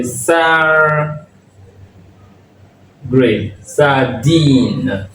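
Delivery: sardine split slowly, a long sar, then a long deen; drawn-out syllables. Shown as a demonstration. Teacher sounds out a loanword, drawn-out syllables. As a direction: stretch each syllable of sardine, long EE in the second. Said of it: "on va prononcer sardines".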